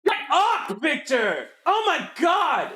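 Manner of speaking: high-pitched exasperated cry; exasperated outburst. Real, not acted. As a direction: squeal at someone to stop it. Mock scolding someone named Victor.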